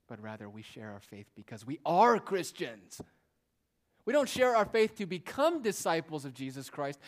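preaching at full force. Acted. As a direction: build to the pulpit climax. Preaching climax.

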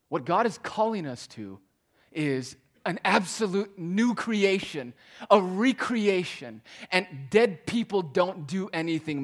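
rising preacher's cry; emphatic preaching. Real, not acted. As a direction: lift it and hammer it home. Preacher building to emphatic climax.

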